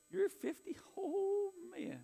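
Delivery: high-pitched disbelief, drawn-out "oh"; astonished. Real, not acted. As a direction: react as if you can't believe it. Teasing a congregant's age in disbelief.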